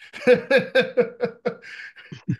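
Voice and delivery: loud, high burst of laughter; real laugh. Real, not acted. Coach after a colleague agrees with him, laughing loudly. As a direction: laugh out loud in a rapid string.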